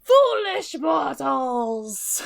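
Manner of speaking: slow booming monster roar; monster shout. Acted. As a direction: drag it out like a giant.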